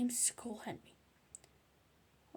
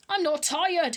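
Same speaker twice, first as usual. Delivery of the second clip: loud, higher protest scream; Henry screaming at his parents; 6 semitones higher, 8 dB louder than usual. A narrator's reading. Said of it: "screamed Henry".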